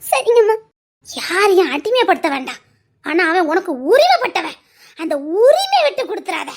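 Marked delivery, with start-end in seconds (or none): none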